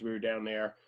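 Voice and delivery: ordinary talk, nothing marked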